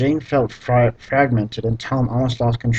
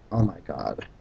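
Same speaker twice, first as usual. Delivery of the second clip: exasperated under-breath mutter; 7 semitones lower, 9 dB quieter than usual. Real, not acted. Despairing at typos.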